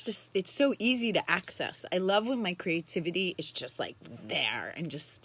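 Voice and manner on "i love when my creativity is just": ordinary talk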